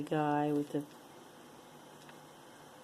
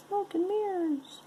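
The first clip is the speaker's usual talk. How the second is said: high playful; 14 semitones higher than usual. Pretend. Playful quip.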